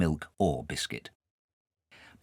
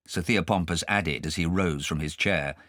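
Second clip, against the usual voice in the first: usual speaking voice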